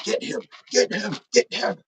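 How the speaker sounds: high-pitched mock sports-fan yell; imitated yelling. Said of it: "I can just remember him yelling".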